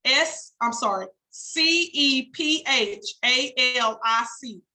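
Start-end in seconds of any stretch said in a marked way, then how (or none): none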